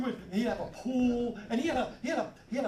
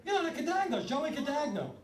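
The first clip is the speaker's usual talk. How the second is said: put-on insisting kid; 8 semitones higher than usual. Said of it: "I'd be like".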